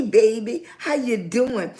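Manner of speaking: ordinary talk, nothing marked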